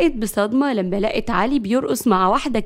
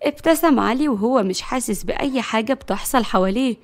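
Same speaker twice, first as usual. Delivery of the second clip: usual speaking voice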